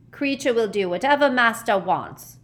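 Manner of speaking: obey through open resentment; grudging house-elf. Acted. Servile elf answering his master.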